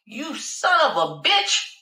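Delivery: acted indignant tirade, opening high; angry cursing. Passenger cursing at the departing cab driver, angry. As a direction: curse them out, dragging the first insult.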